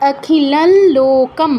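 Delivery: "অখিলাল লোকম" slowly, with a long nasal half ল between the words; slow nasal-l demo. Shown as a demonstration. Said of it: "তাই ল অর্ধেক উচ্চারণ করছি". ("অখিলাল লোকম", ধীরে, অর্ধেক ল নাকে টেনে)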